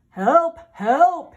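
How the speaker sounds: loud call for help; radio call for help. Acted. Policeman calling on radio.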